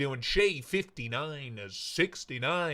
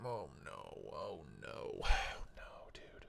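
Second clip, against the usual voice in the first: very soft, breath-only dismay; dismayed whisper; 11 semitones lower, 14 dB quieter, slower than usual. Real, not acted. Streamer fears the audio is broken, dismayed whisper. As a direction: whisper it to yourself, sighing.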